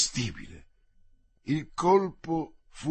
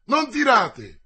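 higher and loud warning; urgent shout; 7 semitones higher, 7 dB louder, slower than usual. Acted. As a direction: stop the shot with a yell. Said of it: "gridò Athos".

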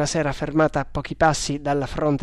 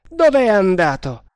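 louder, higher, desperate question; desperate outburst, raised voice; desperate demand; 5 semitones higher, 8 dB louder than usual. A narrator's reading. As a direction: demand it in despair. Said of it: "esclamò con disperazione".

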